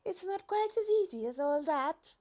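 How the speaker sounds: high, light giraffe voice, politely worried; high fretful giraffe voice. A narrator's reading. Giraffe character voice.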